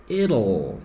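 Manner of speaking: "it'll" said as two quick syllables, "it-l"; careful contraction demo. Shown as a demonstration. Teacher modelling the hard "it'll" for students. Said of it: "presta atenção na minha pronúncia".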